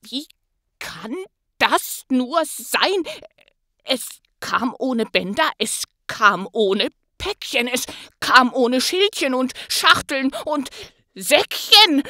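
loud, baffled Grinch voice building to a cry; puzzled Grinch. Acted. The Grinch wonders how Christmas came anyway, baffled and loud.

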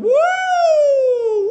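very high, long wailing "woo"; loud drawn-out siren whoops; siren imitation. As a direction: wail like a goal siren.

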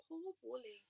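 usual speaking voice

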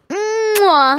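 kiss sound. Acted. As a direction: blow a noisy kiss.

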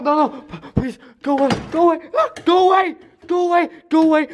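high, frantic begging to be left alone; terrified high-pitched cries; panicked pleading. Acted. Begging an attacker to leave.

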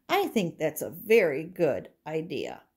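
ordinary talk

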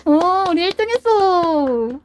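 drawn-out loud cry of joy; shrieking jackpot winner. Real, not acted. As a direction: stretch it out, thrilled and loud. Winning first place in match.

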